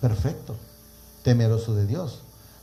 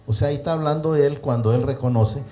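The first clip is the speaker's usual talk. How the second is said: ordinary talk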